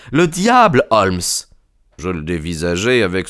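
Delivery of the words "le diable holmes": high-pitched, emphatic exclamation; agitated young friend. A narrator's reading. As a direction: burst out with it, high and urgent. Son revealing who the visitor was.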